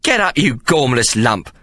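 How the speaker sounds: slow, scornful command; a mild snapped rebuke; snapped order. A narrator's reading. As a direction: order him up with contempt. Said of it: "Mary snapped".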